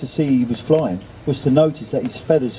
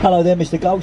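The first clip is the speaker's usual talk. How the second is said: lightly voiced bird character, pitched up; a higher-voiced hello to another bird; friendly greeting; 6 semitones higher, faster than usual. A narrator's reading. Nelson greets the gull, in a lightly raised character voice.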